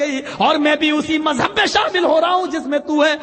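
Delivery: shouting. Retold or imitated. Hurmuzan shouting his conversion to Umar, shouting. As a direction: shout it out with conviction. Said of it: "چیختے ہوئے کہتا ہے".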